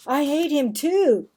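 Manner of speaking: loud, sullen snap; angry outburst. Acted. Marvin tells his mother he hates Nick too, loud and angry. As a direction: shove the words out, fed up. Marvin sulking about friend.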